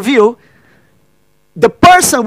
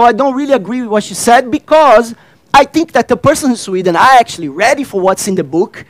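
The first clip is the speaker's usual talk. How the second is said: usual speaking voice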